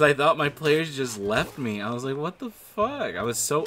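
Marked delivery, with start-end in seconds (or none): 2.2-3.1 mock outraged. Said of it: "I was mad".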